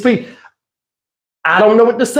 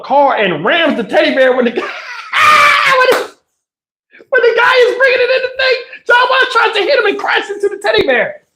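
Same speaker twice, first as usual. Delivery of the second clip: laughing while telling. Real, not acted.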